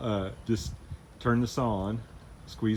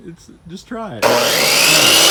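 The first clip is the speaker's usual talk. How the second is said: loud, slow urging; loud coaxing; 2 semitones higher, 13 dB louder, much slower than usual. Real, not acted. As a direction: draw it out loud to push her on. Coaxing a nervous friend.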